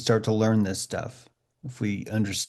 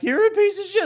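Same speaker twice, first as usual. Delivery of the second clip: much higher squeaky mocking voice; mock friend taunt; 3 dB louder than usual. Friends jeering at him about his water heater, squeaky mock. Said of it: "Your friends are like".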